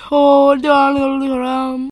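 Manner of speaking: high, loud, slow put-on accent as Trump's wife; weird mock accent. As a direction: swoon the name out slowly in a silly accent. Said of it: "he's like".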